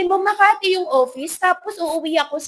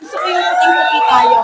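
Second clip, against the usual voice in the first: laughing; 6 semitones higher, 5 dB louder than usual. Real, not acted. Laughing loud aside.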